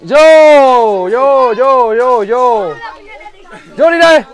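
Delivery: loud, drawn-out call to come over. Real, not acted. Vlogger waving people in to join, calling out.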